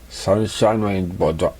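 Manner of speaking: plain reading voice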